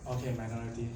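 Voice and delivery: ordinary talk, nothing marked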